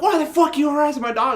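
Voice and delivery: high, fast mock yell; acting out dad yelling, high and quick; imitated angry yelling. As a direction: fire it off like an outraged dad. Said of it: "It's like yelling at this guy".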